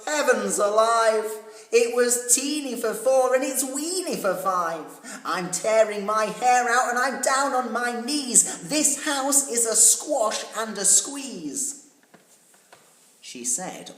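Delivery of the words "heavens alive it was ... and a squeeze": loud, high, despairing outcry; old lady at her wits' end; frantic crying out. A narrator's reading. Old lady wailing about the crowded house, loud and high. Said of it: "The little old lady cried".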